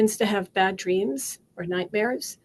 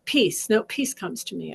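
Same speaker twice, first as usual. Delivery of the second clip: normal talking voice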